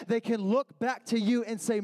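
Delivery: ordinary delivery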